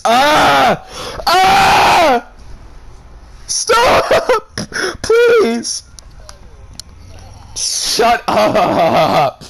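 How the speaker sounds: shrieking "Stop! Please!" in disgust; desperate screaming. Real, not acted. Begging story to stop.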